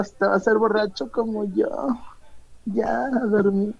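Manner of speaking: put-on lightly slurred, low drunk voice; town drunk. Voices drunk friend telling Renato. (voz de borracho de cantina)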